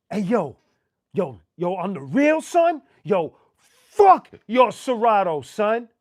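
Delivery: loud, high "fuck your Serato, son"; mock yell at the DJ. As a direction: shout it at him on the open mic. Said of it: "But it's in the audience".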